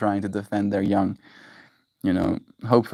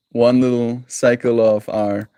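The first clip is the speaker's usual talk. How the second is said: ordinary talk, nothing marked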